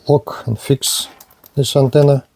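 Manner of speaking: usual speaking voice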